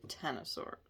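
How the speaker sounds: breathy, soft misread of the card; wrong card, whispered. Real, not acted.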